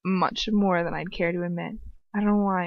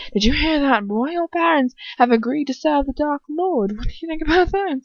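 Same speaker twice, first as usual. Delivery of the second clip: higher-pitched, goading villain voice; malicious taunt; 7 semitones higher than usual. A narrator's reading. Lucius telling Ron of his parents, malicious. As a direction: taunt the boy with relish. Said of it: "his voice laden with malice".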